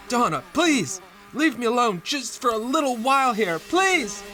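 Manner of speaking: high-pitched, frantic begging; desperate pleading. Acted. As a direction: beg her to back off. Husband begs wife to leave.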